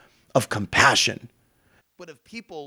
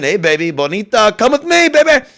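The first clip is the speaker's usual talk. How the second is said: catcalling; 3 semitones higher, 3 dB louder than usual. Acted. Catcalling a woman.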